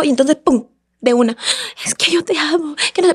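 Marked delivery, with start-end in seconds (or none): 1.8-3.1 higher, softened mock lovesick plea; mock pleading manipulator. Woman imitating her ex suddenly declaring love, mock pleading. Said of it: "Cambiaba a, a manipulador".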